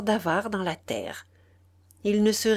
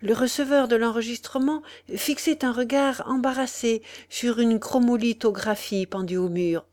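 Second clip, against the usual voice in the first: ordinary delivery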